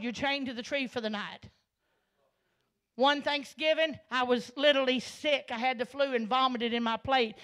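loud, driving preacher cadence; preaching at full force. Real, not acted. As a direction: preach it to the congregation with fire.

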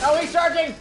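excited warning. Real, not acted. Enemy charges in game.

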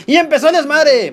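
high-pitched hyped shout; excited outburst. Real, not acted. Streamer as the death match kicks off, excited outburst. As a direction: announce the mayhem with a jump in pitch. Match turns chaotic as it starts.

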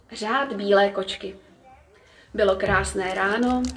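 ordinary delivery

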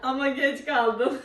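laughing hard. Real, not acted. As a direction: crack up while talking. (kahkahalarla gülerek)

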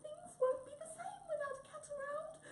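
very high, squeaky tearful wail; hammed-up little mouse crying; sobbing mouse. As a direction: sob it in a tiny mouse voice. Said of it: "sobbed Mouse".

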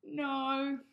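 high, loud sleepy cat voice; yawning old cat. Acted. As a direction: yawn out the refusal. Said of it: "yawned the old cat".

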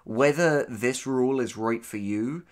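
normal talking voice

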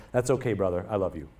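soft, gentle quoted reply with no offense taken; gracious forgiveness. Acted. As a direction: say it softly, like forgiving a brother who stepped on you.

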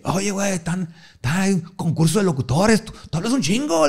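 mock friend voice, high and pushy; imitating a hyped friend. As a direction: egg him on like an eager pal. Friend voiced in retold story.

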